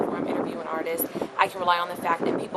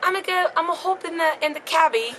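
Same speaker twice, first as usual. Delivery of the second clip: much higher, hammed-up British accent; overdone English accent; attempted English accent; 9 semitones higher, 4 dB louder than usual. Shown as a demonstration. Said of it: "I say that".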